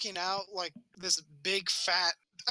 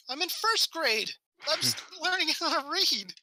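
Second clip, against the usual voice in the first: put-on high-pitched panicked kid, slow and emphatic; freaking out; 9 semitones higher than usual. First grader defends himself to the librarian, mock freak-out. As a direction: protest like a flustered child. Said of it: "I started freaking out".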